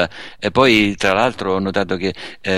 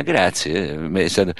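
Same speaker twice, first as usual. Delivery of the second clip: usual speaking voice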